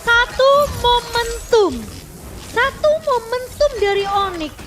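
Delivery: slow, drawn-out loud commentary; hype caster call. Real, not acted. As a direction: stretch each word for the big moment. Caster drawing out the big moment. (komentator bersemangat)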